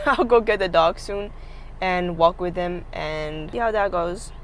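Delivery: ordinary talk, nothing marked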